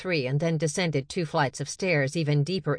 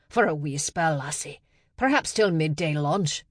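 jokey Scottish burr, Sean Connery style; Sean Connery accent. Acted. Lab assistant answering Nala in a mock Scottish accent. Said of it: "keep the Sean Connery accent going".

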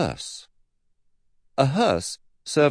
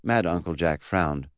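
ordinary delivery, nothing marked

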